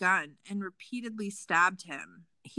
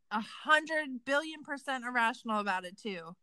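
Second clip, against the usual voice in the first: ordinary talk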